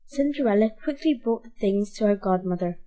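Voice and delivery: ordinary delivery, nothing marked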